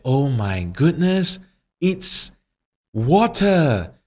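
slow, high dawning shock; shocked fire. Acted. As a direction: gasp it out as it dawns.

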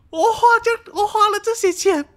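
high, loud, half-laughing lament; venting over the broken massage chair; exasperated venting. Real, not acted. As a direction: complain like the universe is against you.

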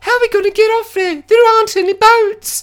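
very high falsetto woman; mock panicked wife. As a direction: imitate a frantic wife in falsetto. Retold wife panicking about escape.

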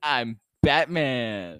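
very slow, loud hero announcement; drawn-out hero line. Acted. Co-host echoing the superhero line, slow and loud. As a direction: stretch each word out loudly.

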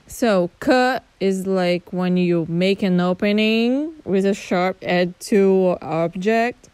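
ordinary delivery

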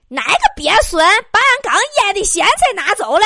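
loud, high hammed-up old-woman rant; old lady cursing. Old lady cursing in the stairwell over pickles, loud high yell. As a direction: yell it out like an outraged country granny. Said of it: "听老太太搁楼道里面骂".

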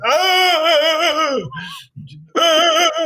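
high pained falsetto cries; mock dying wail. Mocking the dying man's high wail. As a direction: wail like a dying man in his throes. Man in throes of dying, mocked.

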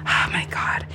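breathy aside whisper. Retold or imitated. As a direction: whisper it to yourself. Aside while retelling awkward moment.

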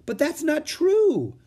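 put-on a little girl objecting; Annie protesting. A narrator's reading. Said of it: "said Annie".

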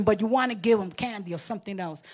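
ordinary talk

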